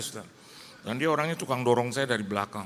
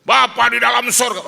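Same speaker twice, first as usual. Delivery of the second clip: "Bapa di dalam sorga" shouted much higher and louder; loud prayer; 12 semitones higher, 15 dB louder than usual. Shown as a demonstration. Praying over food in a mall, far too loud. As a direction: bellow "Bapa di dalam sorga" like a street preacher. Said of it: "Anda jangan kenceng-kenceng".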